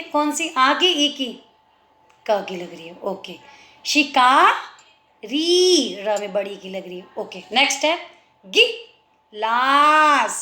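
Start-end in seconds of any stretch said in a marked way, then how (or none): 3.9-5.9 शिकारी stretched very slowly, शि का री each held; split into syllables. Shown as a demonstration. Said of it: "श में छोटी की मात्रा". 8.4-10.4 गिलास drawn out very slowly as गि लास; teacher breaking up a word; syllable by syllable. Shown as a demonstration. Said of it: "एक एक word को तोड़ के दिया जाता है".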